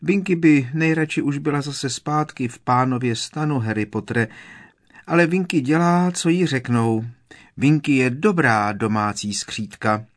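high elf squeak talking of herself; dutiful elf. A narrator's reading. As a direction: recite your duty like a creed.

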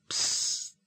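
voiceless urgent psst; hushing shush. Acted. Hermione desperately hushes Harry, urgent shush. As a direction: hush him desperately. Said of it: "tišila ho zoufale Hermiona".

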